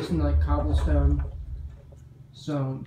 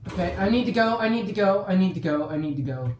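panicked, fading to calm; 7 semitones higher, faster than usual. Real, not acted. Fleeing in a panic, calming.

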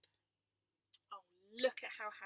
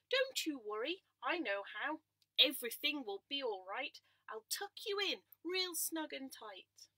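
louder, kindly cow voice; motherly cow; 5 semitones higher, 9 dB louder than usual. Acted. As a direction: comfort the lamb as a big cow. Missus Cow reassures lamb.

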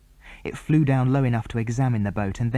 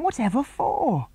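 high, sharp question; exasperated disbelief; 13 semitones higher than usual. A narrator's reading. The children to Tom, high exasperated question. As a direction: demand an answer.

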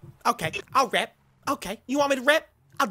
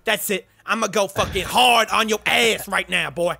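a put-on Kanye voice, brash and cocky; [name] impression; 2 semitones lower than usual. Trash-talking strangers in game chat, brash Kanye voice. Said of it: "You guys wanna hear my real voice?".

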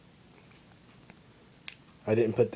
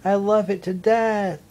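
high, loud gushing praise; gushing sweet girl; 7 semitones higher, 5 dB louder than usual. Retold or imitated. Friend fawning over a fat cat, high gushing. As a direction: coo over the cat, pitched up. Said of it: "And she's like".

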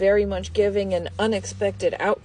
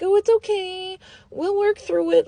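high, louder, sugary mock comfort; mock sweet reassurance; 11 semitones higher, 5 dB louder than usual. Reader mocks the cheater's partner soothing him, high mock sweetness. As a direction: imitate a partner faking forgiveness. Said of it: "they're all acting all crazy like that".

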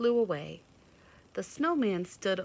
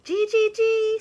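very high, loud birdy gee gee gee; chickadee exclaiming; 13 dB louder than usual. A narrator's reading. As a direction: chirp in delight. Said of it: "said the chickadee dee dee".

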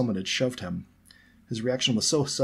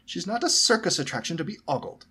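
higher-pitched motherly scolding; disapproving; 9 semitones higher, 3 dB louder than usual. A narrator's reading. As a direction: reprimand the child indignantly.

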